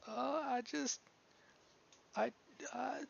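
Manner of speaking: put-on high, halting girl's voice near tears; a lightly voiced girl choking up; young woman breaking off, close to crying; tearful young girl. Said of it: "she starts to cry again".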